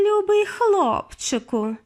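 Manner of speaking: high, slow, honeyed coo; cooing witch. A narrator's reading. Grand High Witch sweetly lures the boy onto the stage. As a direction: coo like syrup to lure the boy. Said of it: "залебеділа".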